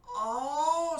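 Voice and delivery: a high, loud oh of sudden understanding; surprised oh. Real, not acted. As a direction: realize it all at once. Sudden realization.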